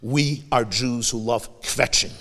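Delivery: normal talking voice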